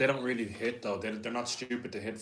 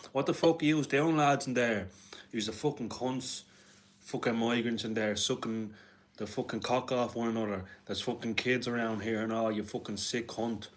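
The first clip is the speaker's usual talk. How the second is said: put-on strong Dublin accent; 2 semitones higher than usual. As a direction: do a rough Dublin accent, yelling at the door then ranting. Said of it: "one of those Dublin people with a really strong accent and say".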